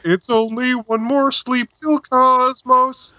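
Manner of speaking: high, slow frog puppet voice; a slow high Kermit impression. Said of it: "Kermit the Frog impression ... Carl Sagan impression".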